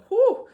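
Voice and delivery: a big, very loud blown-out whew; loud exasperated whew. Real, not acted. Vlogger recalling her anger, huge exasperated whew. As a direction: blow out the leftover anger. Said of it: "I was mad about that".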